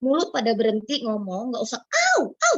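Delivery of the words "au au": high, loud mock yelps of fear; frightened yelps. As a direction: yelp like a scared grandma. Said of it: "gitu, ketakutan". (teriakan takut pura-pura)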